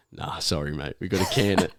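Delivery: low, soft, apologetic retelling of the examiner; imitating a quiet letdown. Examiner telling the learner driver the test is off, quietly. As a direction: drop the voice, mock sorry. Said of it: "comes back out and just goes".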